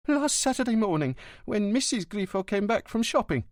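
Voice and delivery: lightly voiced concierge; old concierge. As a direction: answer like a gossipy porter.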